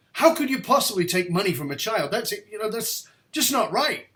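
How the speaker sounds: high, loud, indignant accusation; indignant outburst. Retold or imitated. Young man accuses his bishop teacher, indignant and loud. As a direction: confront him, appalled, voice climbing. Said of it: "I can't bear it".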